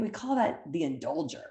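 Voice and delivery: ordinary delivery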